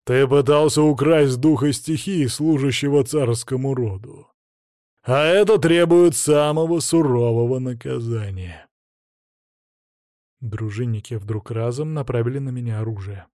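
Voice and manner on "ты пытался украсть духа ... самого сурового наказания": louder, stern royal decree; громче. A narrator's reading. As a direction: raise the voice, stern. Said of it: "добавил громче". (громко и сурово)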